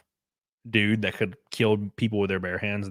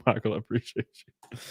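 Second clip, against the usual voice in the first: normal talking voice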